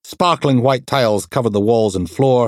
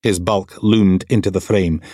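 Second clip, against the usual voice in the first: usual speaking voice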